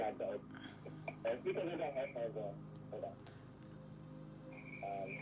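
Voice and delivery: muffled remote voice. Real, not acted. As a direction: voice muffled by headphones. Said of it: "You sound muffled".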